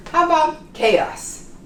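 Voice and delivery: ordinary talk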